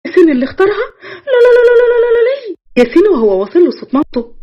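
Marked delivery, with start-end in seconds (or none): 0.1-0.9 much higher, laughing with joy; overjoyed. Acted. Yassin's mother hears he chose the bride, overjoyed. 1.0-2.6 very high joyful zaghrouta trill; ululating. Acted. The mother ululates for her son's engagement. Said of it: "فضلت تزغرط".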